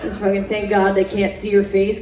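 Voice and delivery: usual speaking voice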